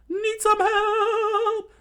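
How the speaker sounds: very high, drawn-out falsetto; loud lovesick squeak; hammed-up eager offer. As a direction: squeal it, stretching the last word. Smitten fighter eagerly offers help.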